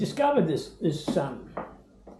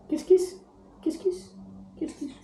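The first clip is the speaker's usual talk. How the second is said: very high cooing "kiss, kiss", fading softer; baby talk to a bird; 13 semitones higher, 4 dB quieter than usual. Acted. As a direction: coo for kisses from the parrot. Asking parrot for kisses.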